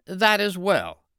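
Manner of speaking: high, loud fairy, satisfied; the bearded fairy giving approval; high fairy voice, pleased. Acted. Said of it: "said the fairy".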